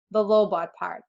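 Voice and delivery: ordinary delivery, nothing marked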